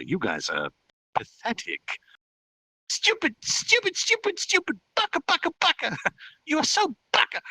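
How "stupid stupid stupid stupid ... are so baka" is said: very high, squeaky tantrum; shouted insults. Acted. Fairy demon lord insulted by comparison, squealing tantrum. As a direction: hurl childish insults in a piercing fairy voice. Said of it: "loudly shouted".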